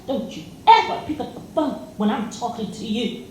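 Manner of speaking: high, far, furious man's rant; angry man cursing. Retold or imitated. Retold date cursing at her on the phone, furious. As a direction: snap at her like an enraged date.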